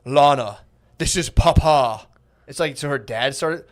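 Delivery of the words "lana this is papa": slow, loud, solemn papa voice; booming like a movie king father; mock deep dad voice. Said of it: "the guy sounds like the sky from the Lion King".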